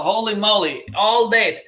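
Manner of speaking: usual speaking voice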